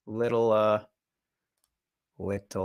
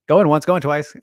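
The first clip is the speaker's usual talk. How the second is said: auctioneer; 6 semitones higher, 10 dB louder than usual. Shown as a demonstration. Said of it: "my auctioneer voice".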